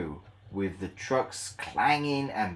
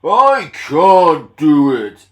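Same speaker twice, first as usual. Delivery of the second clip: sulky Gordon; 5 semitones higher, 16 dB louder, much slower than usual. Acted.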